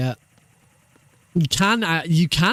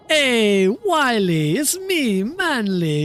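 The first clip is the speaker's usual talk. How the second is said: Manly Coyote; 5 semitones higher, slower than usual. Acted. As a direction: brag like a smug rich cousin. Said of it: "Manly Coyote sounds like Wile E. Coyote's really successful cousin".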